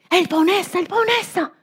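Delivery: high excited cry of recognition; excited shout. Acted. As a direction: point him out to everyone. Women spot the owner.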